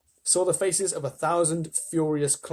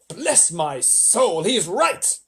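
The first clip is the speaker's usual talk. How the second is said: slow, loud astonished exclamation; old grasshopper exclaiming; 5 semitones higher, 5 dB louder, much slower than usual. A narrator's reading. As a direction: marvel aloud like an old gentleman. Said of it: "the old green grasshopper exclaimed".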